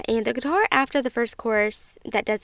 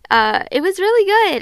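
high, loud and overcome; trying to speak through real tears; emotional; 9 semitones higher, 9 dB louder than usual. Real, not acted. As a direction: still choked up after a sad song. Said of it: "I'm sorry, I can't get control of myself".

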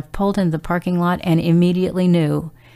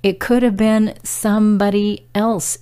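usual speaking voice

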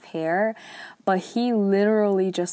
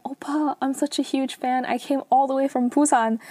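higher-pitched, lightly acted fangirl plea; mock starstruck fan, pitched up; gushing fangirl; 6 semitones higher than usual. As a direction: gush like a fan meeting her idol. Gushing fan meets her idol.

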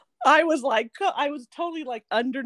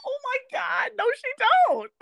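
high-pitched laughter over every word; cracking up, barely getting words out; laughing fit; 12 semitones higher than usual. Real, not acted. Friend recapping an awkward TV moment, laughing hard and high.

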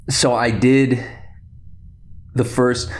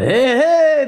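high, squeaky little creature cry; Babu Frik; 4 dB louder than usual. Retold or imitated. Tiny movie creature calling out, high playful imitation. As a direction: squeal it high, stretching the second one. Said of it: "Babu Frick in that movie".

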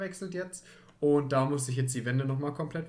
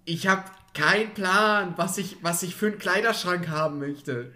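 higher, louder, slow exasperation; exasperated; 5 semitones higher, 6 dB louder, slower than usual. Real, not acted. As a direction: throw up your hands. Exasperated about wardrobe.

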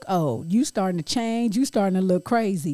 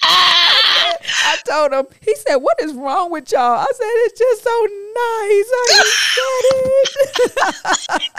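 high, loud, slow laugh-crying; laughing hard; 12 semitones higher, 6 dB louder, slower than usual. Real, not acted. Friend laughing at her own tale. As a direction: tell the story while laughing to tears. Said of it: "it's so funny".

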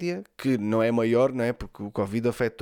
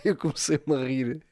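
ordinary talk

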